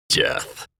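low-volume, menacing whisper; not like himself. A narrator's reading. Maddened fighter answering his master, menacing whisper. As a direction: whisper it like something possessed. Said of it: "It didn't even sound much like me".

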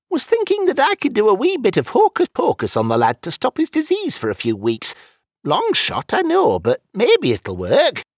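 high-pitched character voice, hopeful; old wizard offering a bit of magic; elderly wizard, tentative. Acted. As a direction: float a long-shot plan modestly. Voices Wizfiddle proposing help.

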